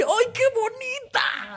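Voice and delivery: very high, slow cooing. Retold or imitated. Cooing at a rat in the street, very high and slow. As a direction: coo at the rat like at a puppy.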